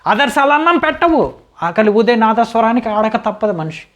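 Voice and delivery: high-pitched, rhetorical outburst; impassioned declaration. Acted. Man admitting ideals don't feed anyone, impassioned. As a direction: launch it high, then keep declaiming.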